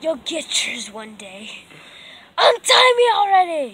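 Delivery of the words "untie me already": loud shouting demand; tied-up captive yells; desperate shout. Acted. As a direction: yell it at your captor.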